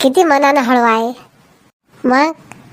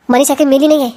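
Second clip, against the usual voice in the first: normal talking voice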